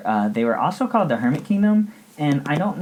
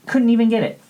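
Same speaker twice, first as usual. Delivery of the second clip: much higher, louder squeal of mock dismay; mock outrage squeal; 13 semitones higher, 6 dB louder, faster than usual. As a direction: shriek it like a teen denied her magazine. Outrage over banned magazines.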